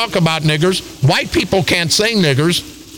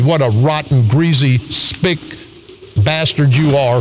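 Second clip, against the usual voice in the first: ordinary talk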